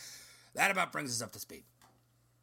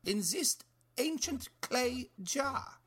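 put-on higher, slow and ominous storytelling voice with a slight German accent; mysterious pickle matriarch; 11 semitones higher than usual. Mirna explaining the pickle golem to the detective, slow and ominous. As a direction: savor the legend like a villain revealing her secret.

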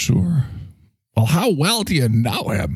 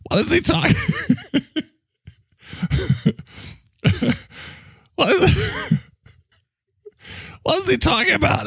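breaking up with laughter while asking; can't get through the question for laughing; faster than usual. Real, not acted.

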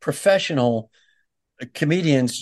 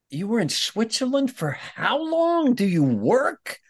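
mock disbelief; 10 semitones higher than usual. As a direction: draw out each question in hammed-up amazement. Acting out disbelief at a guest's tangent.

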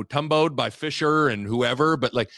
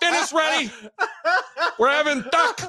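put-on mom calling kids. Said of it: "the Raiola mom calling him for dinner".

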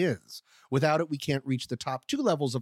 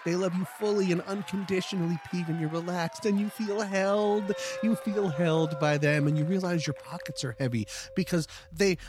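higher sing-song cultish calm; creepy cult meditation; 5 semitones higher than usual. Acted. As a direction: lure him in, sweetly sinister. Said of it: "one of my famous guided meditations".

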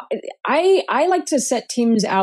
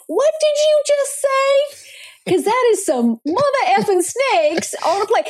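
playful imitation calling out a jargon talker; Sam Jackson impression; 12 semitones higher than usual. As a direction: call out the jargon like the movie tough guy. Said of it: "if we could all be Sam Jackson".

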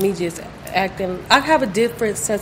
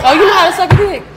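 shocked teasing; 8 semitones higher, 8 dB louder, faster than usual. Real, not acted. Loud teasing question.

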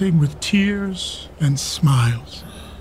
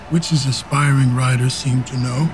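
ordinary delivery

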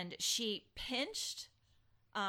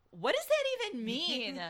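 higher, baffled; incredulous; 9 semitones higher, 4 dB louder than usual. Retold or imitated. Retelling reaction to grandma, higher and incredulous. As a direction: act truly bewildered.

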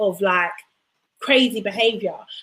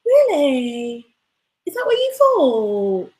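impression of Lucinda; 3 semitones higher, much slower than usual. Imitating Lucinda to friends, slow and wide-eyed. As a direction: copy her dreamy way of talking. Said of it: "but she talks like this".

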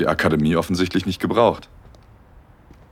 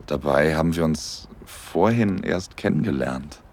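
intimate story narration. A narrator's reading. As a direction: tell it gently like a romantic audio story.

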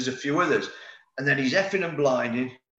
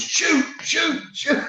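imitating a yell; much slower than usual. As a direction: shout it like a teammate screaming behind you. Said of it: "like that".

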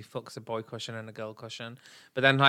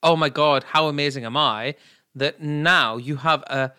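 ordinary delivery

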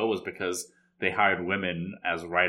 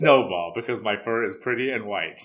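louder, lightly imitated robot dog; playful mock-robot quote of a talking dog; robotic dog voice; 2 semitones higher, 7 dB louder than usual. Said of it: "talking in, like, a robotic voice".

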